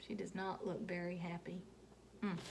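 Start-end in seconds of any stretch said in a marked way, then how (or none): none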